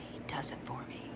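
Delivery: breathy whispered confession; whispered aside. Acted. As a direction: whisper it to yourself. Narrator's inner thought, whispered.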